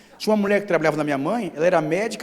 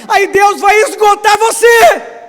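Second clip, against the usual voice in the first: shouted preaching, high and loud; preaching at full force; 16 dB louder, slower than usual. Acted. Pastor preaching to the church, shouting. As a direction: thunder it out over the congregation.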